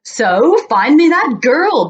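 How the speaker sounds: loud, high, slow royal bellow. Acted. King bellowing for the girl, loud and slow. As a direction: roar the order. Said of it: "bellowed the king".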